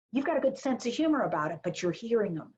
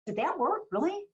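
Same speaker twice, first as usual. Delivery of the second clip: higher, playful disbelief; curious amazement; 9 semitones higher than usual. Pretend. Owner asking a competitor, mock amazed. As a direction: sound impressed and nosy. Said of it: "go to your direct competitor and say".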